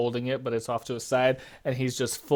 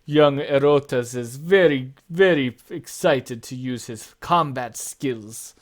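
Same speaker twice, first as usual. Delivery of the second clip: much louder, higher booming host voice; a big jolly father amused by his son; father laughing off the boy's eagerness, loud and high; Pericles laughing; 4 semitones higher, 8 dB louder than usual. Acted. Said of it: "He's like".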